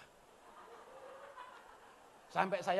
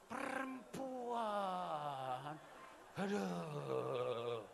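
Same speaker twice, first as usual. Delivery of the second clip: keluh panjang; 6 dB quieter, much slower than usual. Real, not acted. Keluhan tentang perempuan belanja.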